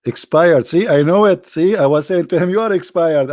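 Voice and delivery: high and loud, gleeful "expired"; triumphant. Real, not acted. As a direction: crow that you knew it all along. Triumph at guessing the meaning.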